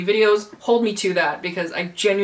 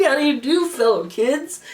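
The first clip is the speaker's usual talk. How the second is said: Steve Buscemi meme voice; 5 semitones higher than usual. Retold or imitated. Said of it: "I'm like the fucking Steve Buscemi meme".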